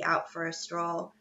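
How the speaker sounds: ordinary delivery, nothing marked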